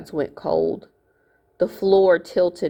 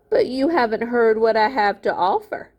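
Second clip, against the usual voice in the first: wicked grin; 6 semitones higher than usual. Acted. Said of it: "grinning wickedly".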